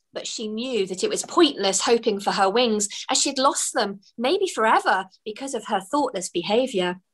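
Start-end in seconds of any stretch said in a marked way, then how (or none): none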